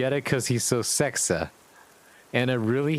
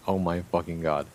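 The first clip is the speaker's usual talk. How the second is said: ordinary delivery, nothing marked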